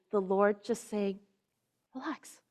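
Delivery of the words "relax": soothing. Retold or imitated. The Lord voiced soothing.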